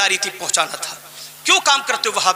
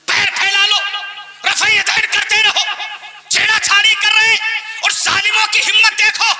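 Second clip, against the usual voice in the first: high-pitched sermon oratory; fiery preaching; 9 semitones higher than usual. Real, not acted. Preacher warning the congregation about a rival group, fiery preaching. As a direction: ring out each phrase high, then pause.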